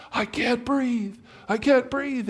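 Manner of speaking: high, slow strained fish voice; gasping fish. Acted. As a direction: choke it out like a fish out of water. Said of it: "and the fish says".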